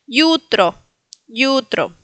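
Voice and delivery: "Yutro" with initial y glide, YU stressed. Shown as a demonstration. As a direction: model "Yutro" as YÚ-tro, falling. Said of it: "se pronuncia como Y". ("Yutro" con i griega)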